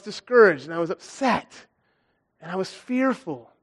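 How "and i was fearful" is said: normal talking voice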